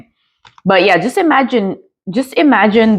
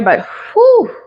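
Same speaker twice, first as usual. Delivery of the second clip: whew. Real, not acted. Reacting to very spicy food.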